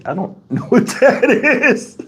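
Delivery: slow words breaking into laughter; real amused bafflement, laughing through the words. Real, not acted. Host stumped by a viewer's message, laughing.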